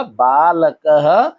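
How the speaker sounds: बालकः very slowly, "baa-la-ka-ha"; drawn-out visarga. Shown as a demonstration. Teacher modelling बालकः for the pupil, drawn-out visarga. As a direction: stretch बालकः syllable by syllable to a final "ha". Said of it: "क्या हो जाएगा?".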